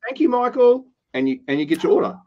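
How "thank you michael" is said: cheery thanks. Retold or imitated. Barista thanking customer.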